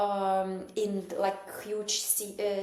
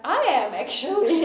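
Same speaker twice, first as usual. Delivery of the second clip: happy reply; 7 semitones higher, 10 dB louder than usual. Retold or imitated. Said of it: "I was like".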